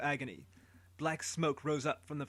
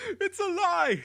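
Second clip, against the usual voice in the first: much higher, loud screaming protest; desperate shout; 9 dB louder, slower than usual. Acted. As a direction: scream your innocence. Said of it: "he shouted".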